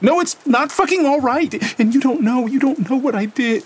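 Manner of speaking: high, loud cry of despair; anguished outburst. A narrator's reading. Lena screaming at Gabriel, anguished. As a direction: break open and shout the guilt.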